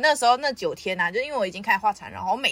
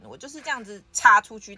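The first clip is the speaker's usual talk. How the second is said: normal talking voice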